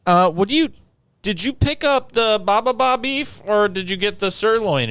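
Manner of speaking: imitating the wife. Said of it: "She's like".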